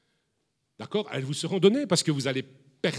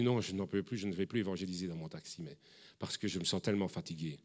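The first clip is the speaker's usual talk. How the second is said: lower, softer, worn-out voice; weary sigh; 8 semitones lower, 11 dB quieter, faster than usual. Retold or imitated. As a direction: let her sound drained and done. Cécile voiced as exhausted.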